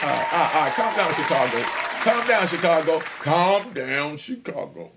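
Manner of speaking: loud, calm down call to the crowd; hushing the cheering Chicago fans, loud. Real, not acted. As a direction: settle the crowd down, loudly. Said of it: "calming the Chicago crowd".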